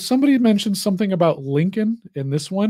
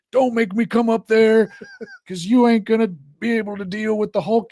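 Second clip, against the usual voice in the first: Hulk Hogan; 6 semitones higher than usual. Retold or imitated. Wrestler warning the gremlins in the theater, put-on tough voice. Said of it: "he gets Hulk Hogan".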